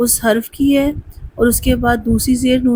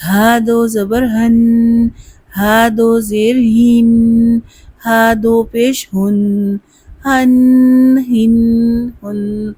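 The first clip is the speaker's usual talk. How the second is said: slow, spelled-out drill for students to repeat; slow drill recitation; much slower than usual. Shown as a demonstration. Teacher leading a spelling drill, slow. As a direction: chant each syllable slowly. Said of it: "ہا عکس حلق سے ادا ہوتا ہے".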